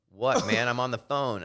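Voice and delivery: mock an annoyed man interrupted mid-call; irritated. Man on a call snaps at stranger in locker room, irritated. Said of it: "And he got all mad".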